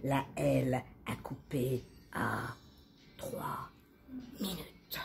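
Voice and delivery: soft, word by word with long pauses; hushed narration. A narrator's reading. Narrator telling of the cut at three minutes, hushed. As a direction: let each word fade away.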